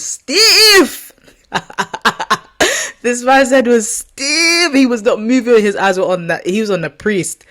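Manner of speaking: laughing through the words while telling it; can't hold back real laughter; giggling helplessly mid-story. Real, not acted. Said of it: "I shouldn't be laughing".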